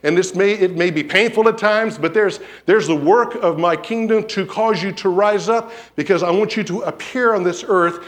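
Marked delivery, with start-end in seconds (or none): none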